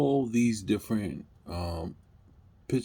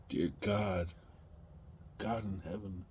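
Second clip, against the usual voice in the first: much softer, pleading to heaven; hushed prayer; 7 dB quieter than usual. Real, not acted. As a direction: drop to a near-whispered plea.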